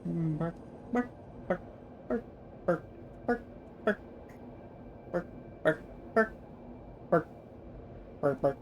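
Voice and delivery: quiet playful bark bark; little muttered woofs; soft barking noises. Pretend. Mimicking machine noises while playing.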